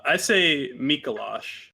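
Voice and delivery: "Mikolash" with an open "ah" in the last syllable; own pronunciation of Mikolash. Shown as a demonstration. Answering co-host on which way he says it, slow MEE-ko-losh. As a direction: say "Mikolash" slowly, ending like "losh". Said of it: "do you say Mikolash or Mikolash".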